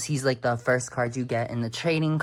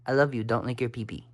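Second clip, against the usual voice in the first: much softer and lower, almost under breath; soft low plea; 6 semitones lower, 6 dB quieter, faster than usual. Real, not acted. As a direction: murmur the plea gently to the cat.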